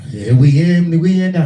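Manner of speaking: loud hyped-up cheer; excited. Retold or imitated. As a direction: pump it up like you're winning. Hyped during the shootout.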